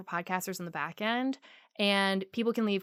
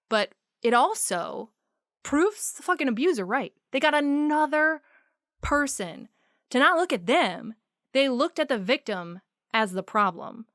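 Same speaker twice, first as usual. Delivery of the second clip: rising, louder, higher; heated rant; 5 semitones higher, 4 dB louder than usual. Real, not acted. Host ranting angrily about victim blaming. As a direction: let the anger build and break out.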